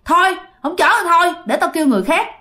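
hammed-up cranky complaint; irritated, demanding. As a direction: berate the boatwoman like a huffy customer. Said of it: "cái giọng bực bội, cái giọng hạch sách".

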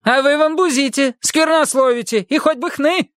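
a bossy housekeeper demanding entry; angry maid shouting. A narrator's reading.

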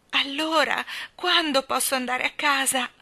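plain reading voice

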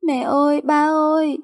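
put-on high, plaintive childish call; whimpering child. Small daughter searching for her parents, whimpering. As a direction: call out tearfully like a little child. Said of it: "vừa nhìn vừa mếu máo khóc".

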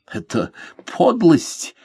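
slow, hissed contempt; through gritted teeth. A narrator's reading. Lord condemns the captain's trick, through teeth. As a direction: squeeze it out through clenched teeth. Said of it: "процедил сквозь зубы лорд Джулиан".

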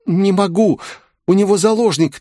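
very high, frightened female voice; trembling little voice. A narrator's reading. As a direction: plead helplessly in a small voice. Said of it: "трясущимся голоском ответила она". (трясущимся испуганным голоском)

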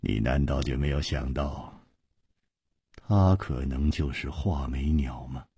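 soft, low, knowing question; 低声点破; low hint. A narrator's reading. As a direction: drop it quietly. Chu quietly dropping a hint.

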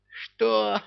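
sharp high indignant "what"; indignant outburst. A narrator's reading. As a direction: flare up, outraged. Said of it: "взвилась Шахерезада". (возмущённо взвилась)

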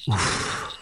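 soft breathy sigh of relief; relieved sigh. Acted. Spy sighs as the spy bats fly off, relieved. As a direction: let the tension out in a quiet puff.

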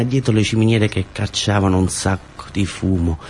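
ordinary delivery, nothing marked

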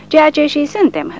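a cocky soldier grinning at the chaplain; shameless grin. A narrator's reading. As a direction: toss it back with a brazen grin. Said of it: "rânjește Porta cu nerușinare".

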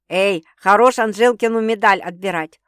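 loud mock protest; feigned indignation. Sister teasing with a friend's title, mock-indignant. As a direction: pretend to be outraged. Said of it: "притворно возмутилась Света".

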